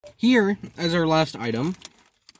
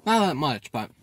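talking while chewing food. Real, not acted. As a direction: mouth partly full. Seen 4: Cheeks bulge, lips pursed mid-chew between words; ate before.

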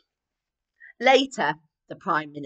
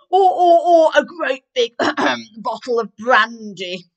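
much louder, higher blustering duke with a laugh; red-nosed duke; 5 semitones higher, 14 dB louder than usual. Acted. Duke proposing brandy to the queen: loud, higher, blustery. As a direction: boom out the brandy idea. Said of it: "said the red-nosed duke".

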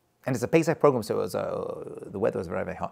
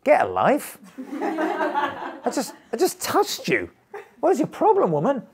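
mock-indignant, high-pitched protest; acted incredulous exasperation; exasperated inner retort; 13 semitones higher, slower than usual. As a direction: rant comically at the other person, pitch climbing. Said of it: "In my head, I'm thinking".

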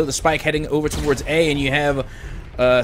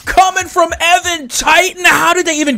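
excited shout; 14 semitones higher, 5 dB louder than usual. Real, not acted. Caster hypes wall bang.

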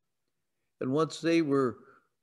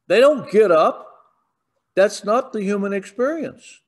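preaching at full force; 4 semitones higher, 6 dB louder than usual. Acted.

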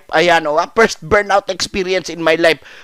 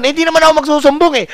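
high, fast excited reassurance; pleading kid promising not to tell; eager promise; 8 semitones higher, 4 dB louder, faster than usual. Retold or imitated.

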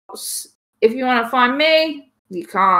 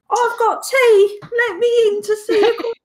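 mimicking her British accent; 13 semitones higher, 4 dB louder than usual. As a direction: imitate her silly British squeal. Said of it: "That's how she sounds".

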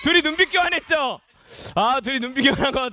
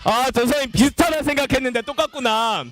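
ordinary talk

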